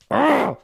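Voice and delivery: high, frustrated "ugh"; exasperated growl. Retold or imitated. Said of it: "was so mad at her".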